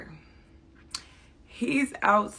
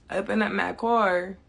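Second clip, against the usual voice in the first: usual speaking voice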